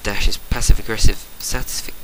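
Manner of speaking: ordinary delivery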